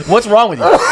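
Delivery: high, loud disbelief; mock alarmed. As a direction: tease the friend as if horrified. Friends scolding the vodka drinker.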